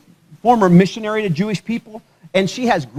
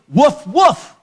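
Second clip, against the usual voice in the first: two higher mock woofs; imitated dog barks; 4 semitones higher than usual. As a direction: bark twice like an eager dog. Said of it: "Your dog goes".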